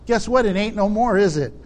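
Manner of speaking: ordinary talk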